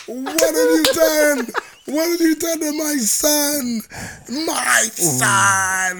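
high, loud, slow hammed-up lament; mock wailing mother. Mother grieving over her son, hammed-up slow wailing. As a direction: wail it like a grieving mother in an opera. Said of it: "on her knees crying".

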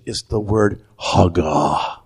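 hagah said slowly and low, HAH-gah; Hebrew word. Shown as a demonstration. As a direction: say hagah with a breathy h, stress on first syllable. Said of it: "Say it".

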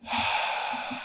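long, soft, breathy sigh; dramatic sigh. Real, not acted. Host winding up for a rant, dramatic sigh. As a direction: let out a drawn-out theatrical sigh. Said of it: "So dramatic".

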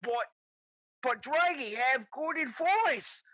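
high, slow cartoon kobold; pleading puppet voice. Kobold head puppet protesting to Boom Boom, high slow put-on voice.

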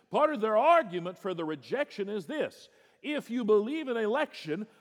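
high, loud pulpit preaching building to a cry; fervent preaching. Acted. Preacher warning his congregation, at full force.

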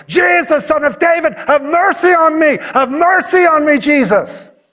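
high, desperate beggar's holler; insistent repeated cry for mercy, strained and high. Acted. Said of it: "he yelled out the louder".

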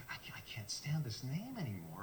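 whispering the line; whispered complaint. Retold or imitated. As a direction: Scheffler whispers his frustration. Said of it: "I'm like".

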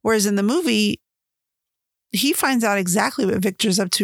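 ordinary talk, nothing marked

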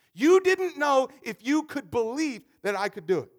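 loud, rising sermon delivery; preaching at full force. Acted. Pastor to his church, preaching loudly at full force. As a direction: drive the story home to the congregation.

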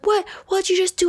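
shouting high in shock; high angry yell. Retold or imitated. Harasser's retorts, shouted high.

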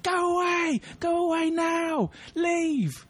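high, shouting, panicked retort; screamed go away. Retold or imitated. Lee yelling at her date from the car park, high shout. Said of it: "I heard a scream".

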